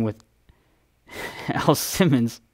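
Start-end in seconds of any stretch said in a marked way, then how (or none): none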